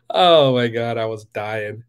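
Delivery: ordinary talk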